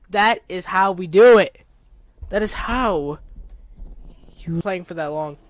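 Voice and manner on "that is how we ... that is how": triumphant. Real, not acted. Celebrating the landing.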